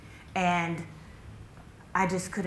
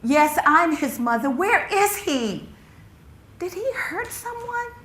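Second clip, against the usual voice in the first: high, urgent mother's demand; climbing panic, pleading for answers; frantic questioning; 10 semitones higher, 7 dB louder than usual. Acted. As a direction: start loud, end high and fearful. Frantic mother questions police officers.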